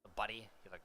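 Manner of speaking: ordinary delivery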